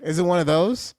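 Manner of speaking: ordinary talk, nothing marked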